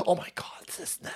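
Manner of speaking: a hushed mocking aside; mock whisper. Mocking a whiny patient in a hush. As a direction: breathe it out like a scandalized whisper. Whispered end of mocked quote.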